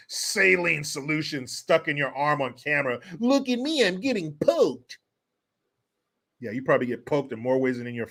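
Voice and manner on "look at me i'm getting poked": high mocking put-on voice; mocking imitation. Mocking an actor getting poked on camera, high mock voice. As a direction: mimic a show-off, pitched up.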